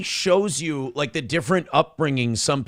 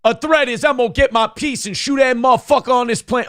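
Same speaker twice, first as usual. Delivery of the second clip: higher-pitched mock tirade; mock hostile passenger; 7 semitones higher, 3 dB louder than usual. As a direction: lightly mimic an irate passenger.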